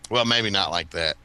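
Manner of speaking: ordinary delivery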